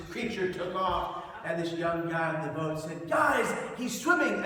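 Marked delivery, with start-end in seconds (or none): 3.1-4.4 loud, high-pitched astonished shout; excited call. Retold or imitated. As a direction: call out the news to the crew. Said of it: "as in".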